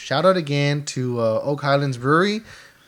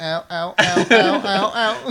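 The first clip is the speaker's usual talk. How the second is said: string of little mock yelps, climbing higher; mock pain; 4 semitones higher, 3 dB louder than usual. Teasing friend who teared up at his piercing, mock pain. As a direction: imitate someone wincing at a sting, rising. Imitating pain at alcohol swab.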